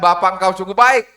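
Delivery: loud, strained cry of the same hymn; desperate shout. Retold or imitated. Preacher mocks the hymn sung from an empty kitchen, loud. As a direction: belt it out like a hungry man.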